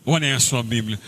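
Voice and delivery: normal talking voice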